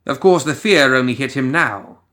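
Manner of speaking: usual speaking voice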